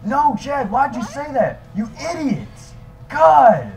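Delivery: high, loud furious yelling at Chad; angry outburst. Acted. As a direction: blow up at your clumsy friend. Yelling at friend who embarrassed him.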